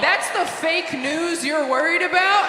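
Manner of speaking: incredulous shout. Real, not acted. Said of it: "incredulous at the post".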